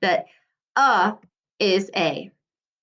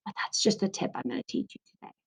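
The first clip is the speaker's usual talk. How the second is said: whispered aside; 12 dB quieter, much faster than usual. Real, not acted. Teacher's quick secret aside.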